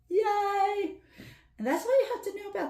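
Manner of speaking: high mock-cheery yay; cheer. Real, not acted. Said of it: "teacher cheers".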